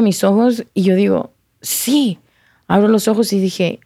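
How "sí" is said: higher, held yes without added volume; mild mock cry, pitched up; retold cry out. Woman waking to her praying cousin, retold cry. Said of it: "cuando grito, que digo".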